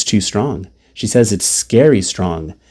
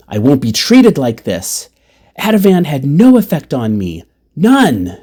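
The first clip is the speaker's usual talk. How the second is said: higher-pitched indignant protest, retold; hating this; 5 semitones higher, 3 dB louder than usual. Mother denies the drug affected her, indignant. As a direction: protest emphatically, punching the last word. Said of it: "She hates this".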